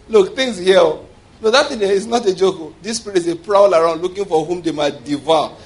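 usual speaking voice

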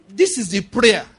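loud, slow declaration in Jesus' name; preaching at full force. Acted. Pastor declaring victory over a marriage, loud preaching. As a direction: proclaim it over the house like a decree.

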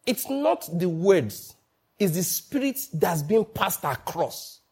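slowly, each word weighed; slow emphasis. Real, not acted. The preacher stresses to the congregation, slow and deliberate. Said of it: "small aside".

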